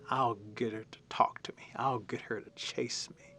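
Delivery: whispered scheming bug; the mud dauber whispers his plan to the warriors, scheming; sly whisper. Acted. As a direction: breathe the plan out secretly. Mud dauber plotting, voice drops.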